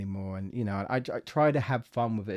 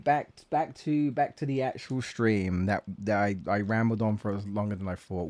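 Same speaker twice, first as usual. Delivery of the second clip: ordinary delivery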